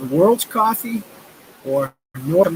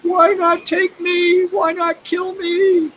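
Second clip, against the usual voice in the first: high, slow wailing plea; imitated sobbing priest; much slower than usual. High slow sobbing plea of a priest in The Fog. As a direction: mimic a priest crying to be taken. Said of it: "crying and sobbing".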